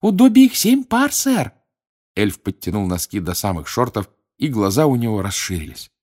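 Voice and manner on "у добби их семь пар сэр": squeaky elf voice. Acted. Dobby voiced.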